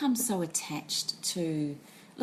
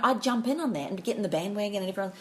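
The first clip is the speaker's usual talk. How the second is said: normal talking voice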